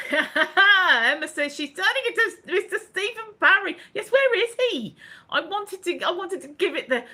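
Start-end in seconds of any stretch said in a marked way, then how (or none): 0.1-5.0 high-pitched reading through laughter; laughing. Real, not acted. Host reading sister's joke aloud, laughing and high. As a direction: burst out laughing and keep reading.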